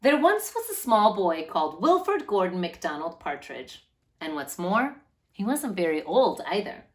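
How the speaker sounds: steady, clear storybook reading; gentle read-aloud to children. A narrator's reading. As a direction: read it like a picture book for a class. Reads picture book to children.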